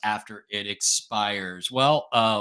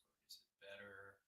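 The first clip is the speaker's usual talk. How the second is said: very soft, dropping to a whisper; quiet test voice; 3 semitones lower, 32 dB quieter than usual. Shown as a demonstration. Streamer asking viewers while speaking very softly, whispering. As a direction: let the voice fade to test the mic. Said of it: "when I talk quietly".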